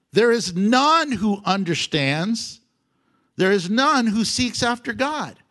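reading the verse out with preacher's force, slow on the first line; scripture reading. Acted. Pastor reading scripture to the church, proclaiming.

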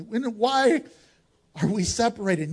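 usual speaking voice

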